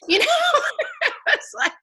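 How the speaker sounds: high, giggly laughter through words; laughing. Real, not acted. Amused at her own story, real laughter. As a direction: laugh it off, delighted.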